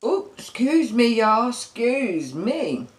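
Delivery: high ooh, then a slow drawn-out excuse me; dainty excuse me. Real, not acted. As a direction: play it prim and slow. Apologising after a burp.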